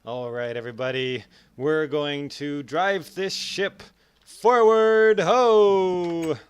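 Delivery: big captain's call, loud on forward ho; rallying cry. Retold or imitated. Captain shouting to his crew to push forward. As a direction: rouse the crew to drive the ship forward. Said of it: "I'm up here and I'm going".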